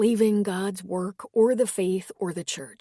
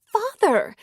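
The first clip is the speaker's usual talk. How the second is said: high, loud breathy gasp of "Father!"; shocked gasp; 14 semitones higher, 8 dB louder than usual. Acted. Shocked woman gasping at the former priest's confession. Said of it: "She gasped".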